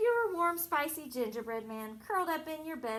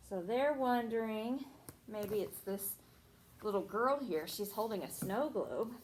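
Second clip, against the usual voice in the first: usual speaking voice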